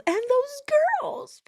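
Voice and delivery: loud-ish, slow, very high fake cry; sudden hammed-up wail of grief; mock wailing. Commentator mimics her remembering the girls, exaggerated fake anguish. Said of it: "Let me just bring up the girls".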